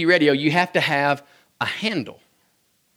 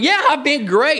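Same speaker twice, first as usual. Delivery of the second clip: put-on much higher, eager child answer; eager kid; 11 semitones higher, 4 dB louder than usual. Boy answering Santa on the radio, high eager chirp. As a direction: chirp it like a proud little boy. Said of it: "he's like".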